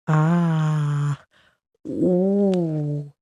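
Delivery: ordinary delivery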